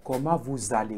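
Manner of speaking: comment vous allez with vous allez joined by a z; linked z sound. Shown as a demonstration. Teacher modelling the liaison in the question. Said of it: "With the linking, we say".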